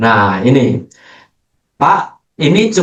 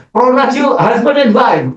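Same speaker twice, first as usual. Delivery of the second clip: raised pastor's pronouncement; mock pastor; 9 semitones higher than usual. Pastor declaring the couple married, high ceremonial voice. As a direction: declare it like a wedding officiant. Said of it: "ya, saya berkata".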